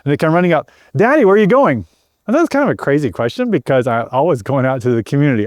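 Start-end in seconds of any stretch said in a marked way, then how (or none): 1.0-1.8 put-on child asking. Child voiced running up to dad.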